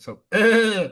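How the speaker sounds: loud high goat bleat; bleating goat laugh. Shown as a demonstration. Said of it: "that's the goat noise".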